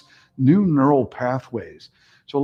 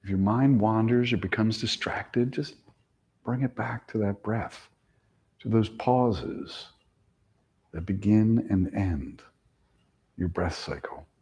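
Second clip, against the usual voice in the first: guided meditation; 3 semitones lower than usual. Acted. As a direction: lull them into the count. Guides breath counting.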